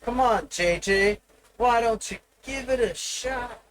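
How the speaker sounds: high, put-on chat voice, teasing; hives impression. Streamer voicing chat daring him to try, high teasing voice. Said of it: "as the hives might say".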